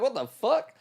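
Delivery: high, loud, stunned exclamation; shocked outburst. Retold or imitated. Retelling his first reaction to the new kid, loud shocked outburst. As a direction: blurt it out like you can't believe your ears.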